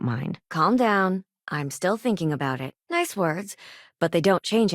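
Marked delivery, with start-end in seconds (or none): none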